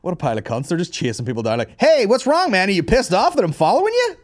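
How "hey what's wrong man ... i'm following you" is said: high, loud taunting paparazzo voice; mock a pushy photographer jeering at a celebrity. Comedian mocking paparazzi chasing celebrities, high and loud taunt.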